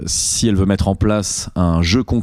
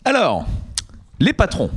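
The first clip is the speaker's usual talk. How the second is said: announcer voice; 10 semitones higher than usual. Acted. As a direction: announce it like a radio host. Podcast host intro announcement.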